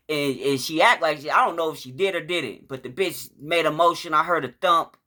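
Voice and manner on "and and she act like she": ordinary delivery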